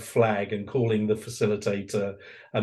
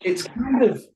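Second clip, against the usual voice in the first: mock exasperation; 10 semitones higher than usual. Mock exasperation ending the point.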